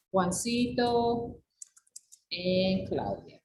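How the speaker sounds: slow, spelled-out correction. Shown as a demonstration. Teacher dictates the corrected names to students, slowly. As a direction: lay the names down one by one. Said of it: "Esta sería la corrección".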